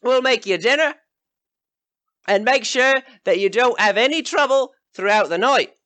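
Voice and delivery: outbid the other hosts; rowdy villager. Acted. Third villager claims the guests.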